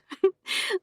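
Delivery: light high giggle; a quick girlish giggle. A narrator's reading. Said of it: "Marta giggled".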